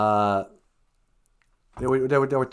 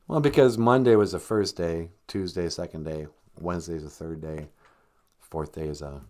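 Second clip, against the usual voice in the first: ordinary talk, nothing marked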